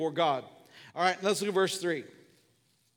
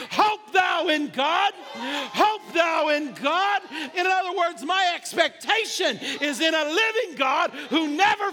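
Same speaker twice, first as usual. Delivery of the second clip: impassioned preaching climax; 7 semitones higher, 4 dB louder than usual. Real, not acted. Preacher urging the room to decide today, impassioned preaching climax. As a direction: build to a full-force declaration.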